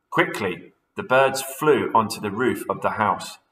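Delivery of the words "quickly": ordinary delivery, nothing marked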